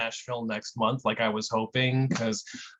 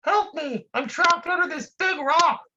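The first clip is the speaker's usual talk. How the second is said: panicked trapped man; 14 semitones higher than usual. Acted. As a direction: yell up from under the rock, desperate. Trapped man shouts louder.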